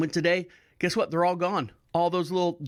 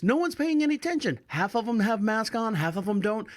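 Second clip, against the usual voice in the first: exasperated rant; 5 semitones higher than usual. Retold or imitated. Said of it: "She's like".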